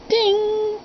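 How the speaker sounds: high, ringing "ding" held on one pitch; imitated piano note. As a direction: mimic a struck piano key being tuned.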